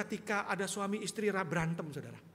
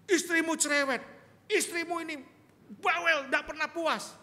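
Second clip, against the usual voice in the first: high, loud exasperated complaint; mock husband ranting about his wife; griping husband; 10 semitones higher, 7 dB louder than usual. Husband complains to Jesus about wife, loud whiny rant. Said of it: "nanya Tuhan Yesus".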